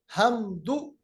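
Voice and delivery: "हमदु" with haa from the middle throat. Shown as a demonstration. Teacher showing the makhraj in "हमदु", mid-throat haa. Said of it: "हा का जो मखरज है वो वस्त हलक से".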